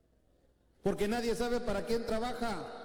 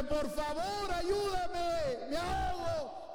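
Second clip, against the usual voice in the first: high mock shouts, more desperate; shouting harder for help; 9 semitones higher, much slower than usual. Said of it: "gritaba más fuerte".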